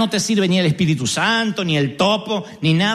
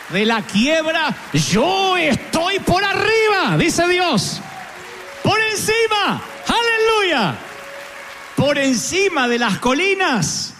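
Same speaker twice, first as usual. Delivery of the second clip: preaching at full force; 7 semitones higher, slower than usual. Real, not acted. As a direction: lift the church with a soaring preach. (predicación con toda la fuerza, aguda y lenta)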